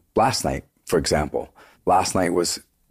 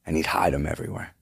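usual speaking voice

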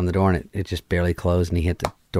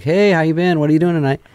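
higher, louder mock-casual come-on; mock flirty text; 9 semitones higher, 4 dB louder than usual. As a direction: read his text like a smooth pickup line. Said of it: "He's just like".

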